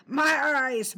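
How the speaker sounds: high, loud cry of pain and panic; terrible cry. A narrator's reading. Blinded servant crying out in pain, high and loud. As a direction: cry out as the cat blinds him. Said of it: "A terrible cry rent the air".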